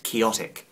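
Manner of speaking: "quixotic" said like Quixote, "kee-OT-ik"; mistaken pronunciation of quixotic. Shown as a demonstration. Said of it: "think that the word is pronounced".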